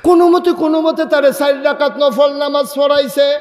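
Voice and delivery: full-force preaching. Real, not acted. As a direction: ring it out to the crowd, pitched high.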